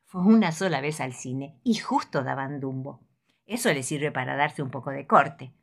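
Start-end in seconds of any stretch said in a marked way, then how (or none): none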